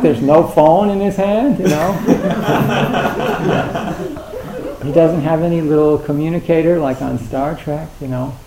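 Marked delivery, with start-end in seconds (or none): 2.0-4.8 airy laugh. Real, not acted. Laughs at phone talkers.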